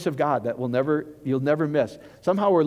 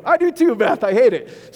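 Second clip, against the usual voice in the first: much higher and louder, gleeful; excited agreement; 8 semitones higher, 7 dB louder than usual. Real, not acted. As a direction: cheer her on with delighted emphasis. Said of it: "I love that honesty".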